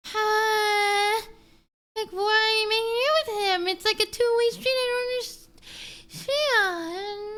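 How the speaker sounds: high, near-wailing disbelief; half-crying high exasperation; exasperated. Real, not acted. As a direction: cry out in frustrated confusion. Exasperated at reality TV couple.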